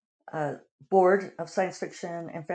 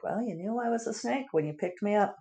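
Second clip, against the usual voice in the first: higher-pitched, lightly acted shrug of a retort; mock retort; 6 semitones higher, 4 dB quieter than usual. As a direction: toss it off as a mock I-told-you-so. Snake's retort voiced to bitten friend.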